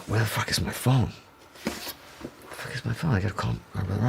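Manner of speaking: soft, near-whispered muttering to himself; hushed urgency. Retold or imitated. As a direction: drop to a secretive hush, as if nobody should hear. Retelling secret search for his phone.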